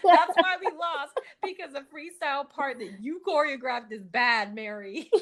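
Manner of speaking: high, loud, laughing through a diva's complaint; laughing sassy quote. Retold or imitated. Yolanda blaming Mary for the loss, said high and laughing. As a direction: giggle out the insult in a high voice.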